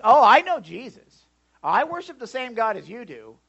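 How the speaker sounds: loud, indignant churchgoer imitation; mock protest. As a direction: blurt it like an insistent self-styled believer. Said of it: "How many times have you heard this?".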